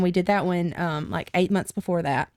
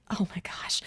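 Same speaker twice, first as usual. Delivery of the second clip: whispered reaction; 10 dB quieter than usual. Retold or imitated. Retold reaction to reading book.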